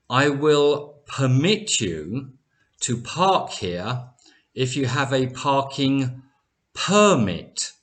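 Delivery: slowly, first "permit" as per-MIT, last "permit" as PER-mit; verb and noun stress. Shown as a demonstration. Teacher gives learners an example sentence, "permit" per-MIT then PER-mit. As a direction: space it out, switching the stress of "permit" from end to front. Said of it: "So permit is a verb".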